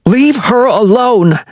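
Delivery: high, slow, pleading shout; frightened defiance. Acted. Wilmer shouting at Chase to leave Viola alone. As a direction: shout to stop her, voice climbing.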